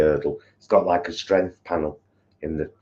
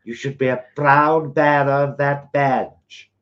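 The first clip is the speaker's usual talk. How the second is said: put-on old newsreel announcer voice; loud, grand wartime newsreader; 1940s newsreader voice; 7 semitones higher, 5 dB louder, slower than usual. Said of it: "a nineteen forties kind of newsreader voice".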